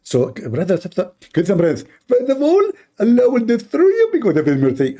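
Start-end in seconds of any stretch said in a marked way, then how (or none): 2.1-5.0 high, mocking preacher voice; Zakir Naik impression. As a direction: parody a lecturing cleric, pitched high. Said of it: "Zakir Naik".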